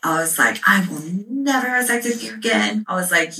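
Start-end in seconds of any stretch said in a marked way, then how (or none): 0.7-2.8 higher-pitched, emphatic indignant declaration; retold furious vow. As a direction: act out an outraged refusal. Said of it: "And I was just furious".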